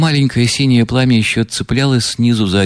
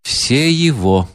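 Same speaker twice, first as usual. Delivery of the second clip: proclaiming; 6 semitones higher than usual. A narrator's reading. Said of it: "провозгласила Настасья Филипповна".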